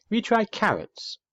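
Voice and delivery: put-on an old lady peering over her glasses; high quavery old lady voice. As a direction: ask brightly like a dotty grandmother. Old lady asks Plop.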